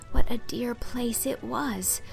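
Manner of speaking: ordinary talk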